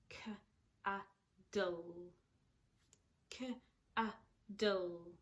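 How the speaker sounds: cuddle split as K-a-dle; sounding out words. Shown as a demonstration. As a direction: say K-a-dle in slow parts. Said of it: "There are actually two sounds".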